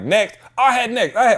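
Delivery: high, pushy imitation of people claiming a place; mock crowd squabble. People in the DMV line fighting over their turn, high and pushy. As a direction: squabble over who is next.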